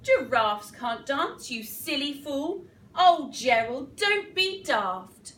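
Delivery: loud, punchy mockery; jeering animals. A narrator's reading. Animals mock the giraffe on the floor, loud jeering. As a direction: scoff loudly, drawing out the last words. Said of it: "the animals all laughed".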